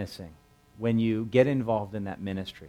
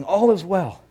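higher, louder gasped announcement; out of breath; 10 semitones higher, 9 dB louder, slower than usual. Retold or imitated. Runner arriving breathless before the king, gasped. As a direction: blurt it out winded from the run. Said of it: "here he is out of breath".

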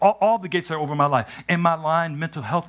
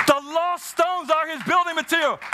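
much higher, ringing preacher's call; preached high repeat; 13 semitones higher than usual. Shown as a demonstration. Pastor proclaims the line to the congregation, high and ringing. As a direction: lift it up and proclaim it. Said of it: "repeated line, first take".

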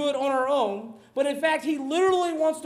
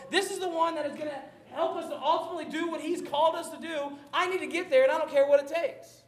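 excited retelling; 5 semitones higher, 5 dB louder than usual. Acted. Excited Peter jumping in sea.

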